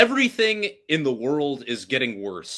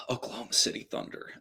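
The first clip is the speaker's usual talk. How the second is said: softly blurted answer; reluctant whisper; 2 semitones lower, 13 dB quieter, faster than usual. Real, not acted. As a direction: whisper it fast, dreading the answer.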